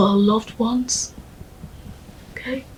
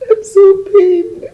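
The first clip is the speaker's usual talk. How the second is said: crying; 11 semitones higher, 8 dB louder, slower than usual. Acted. Widow grieving her husband.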